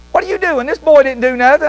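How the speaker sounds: put-on high-pitched, reproachful old lady; little old ladies. Old ladies defending the crying boy, scolding. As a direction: imitate church ladies taking the boy's side. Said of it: "these little old ladies".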